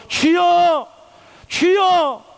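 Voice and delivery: high, drawn-out cry to the Lord; desperate earnest cry. Shown as a demonstration. Preacher leading prayer from the depths, desperate high cry. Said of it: "영혼 깊은 곳에서 끌어올리는 그런 간절함으로".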